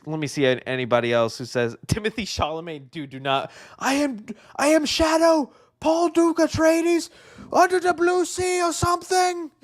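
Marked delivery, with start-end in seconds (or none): 3.8-9.5 high-pitched, hammed-up hero proclamation; mock Shadow. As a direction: declaim it as a silly high-voiced Shadow. Mock audition as Shadow with Chalamet.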